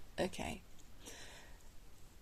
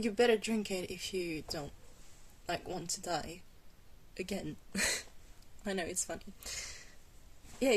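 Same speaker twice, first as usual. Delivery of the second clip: ordinary delivery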